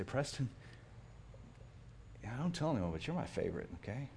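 soft, hushed confiding to his son; hushed secret. Retold or imitated. Dad softly telling his son a secret, hushed. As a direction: whisper it like a secret just between you two.

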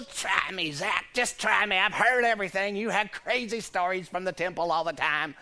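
lightly voiced old wife; a hint of a weary old wife; mild old-wife voice; old woman voice. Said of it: "change the voice a little bit".

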